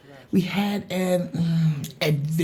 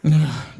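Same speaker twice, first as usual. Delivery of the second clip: weary sigh; 3 semitones lower, 4 dB louder than usual. Acted. Weary before "don't remind me.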